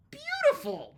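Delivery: high, loud beautiful; a gleeful shout of delight. Real, not acted. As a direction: savor the win. Delighted exclamation.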